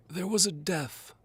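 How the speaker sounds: put-on high, slow faltering old duke; stammering Duke. A narrator's reading. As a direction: stumble over the words. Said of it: "stammers the Duke".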